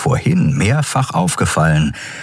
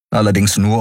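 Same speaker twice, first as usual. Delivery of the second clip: usual speaking voice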